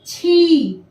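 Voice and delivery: छी with a stretched long ii; drawn-out long ii. Shown as a demonstration. Teacher modelling छी for children. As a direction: draw out the vowel of छी. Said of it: "छ में लगने से होगा".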